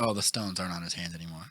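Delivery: muttering to yourself in the theater; hushed realization. Retold or imitated. A viewer noticing the stones gone, quiet and quick.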